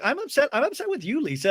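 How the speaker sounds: high mock-upset whine; Brittany. Woman upset with her friend, in high mock distress. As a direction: pitch it way up as if wounded. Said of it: "And Brittany's like".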